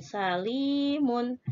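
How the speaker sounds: salimun said slowly, li held long; drawn-out long vowel. Shown as a demonstration. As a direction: hold the li in salimun. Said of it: "i-nya berarti ya sukun".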